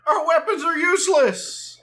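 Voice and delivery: high-pitched mock panic; hammed-up cry of a helpless cartoon policeman; panicking game cop. Dubbing police failing against the monster, mock panic.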